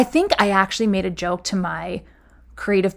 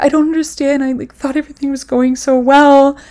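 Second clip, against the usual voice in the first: higher, tearful-sounding plea; upset pleading; 6 semitones higher, 4 dB louder than usual. Retold or imitated. To the bosses firing her, an upset high plea. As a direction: plead as if about to cry.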